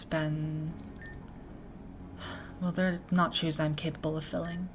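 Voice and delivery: soft, measured voice with long pauses; reflective academy leader, subdued. Acted. Academy leader reflecting on a missing predecessor, subdued and soft. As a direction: speak quietly and thoughtfully, weighing each thought.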